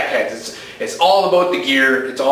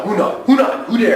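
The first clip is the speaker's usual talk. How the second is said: mock dramatic slogan; 8 semitones higher than usual. Cohost turning a verbal slip into a grand motto. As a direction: proclaim it like a movie tagline.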